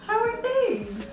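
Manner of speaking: 高而轻快的随意问候; bright, upbeat casual hello between friends; lively informal greeting. Acted. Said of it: "two friends meeting ... informal English".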